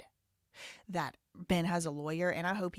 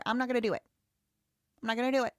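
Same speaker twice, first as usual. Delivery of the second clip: louder, higher flat refusal; defiant refusal; 6 semitones higher, 5 dB louder, much faster than usual. Real, not acted. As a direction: dig in your heels and refuse. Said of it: "I will not lower my voice".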